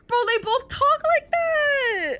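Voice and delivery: high, slow, squealing amusement; squealing laugh. Real, not acted. To chat, squealing amusement at the archaic talk. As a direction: squeal in delight.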